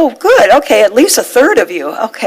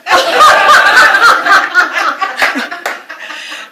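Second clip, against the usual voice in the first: laugh; 10 semitones higher than usual. Real, not acted. Laughing at her own story.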